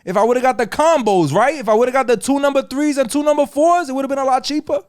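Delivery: exasperated rant. Retold or imitated. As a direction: argue the math, fed up. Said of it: "I was like".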